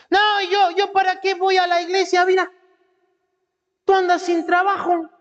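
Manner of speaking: high-pitched griping yell; gritoneando. Acted. Bitter believer griping about going to church, high-pitched shouting. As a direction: yell out a loud, high complaint. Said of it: "todos gritoneando".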